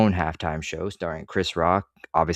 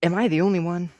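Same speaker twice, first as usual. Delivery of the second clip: high-pitched mock-incredulous question; sarcastic, rhetorical; 10 semitones higher, faster than usual. Real, not acted. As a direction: ask it high, with sarcastic disbelief. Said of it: "I'm being sarcastic slash rhetorical".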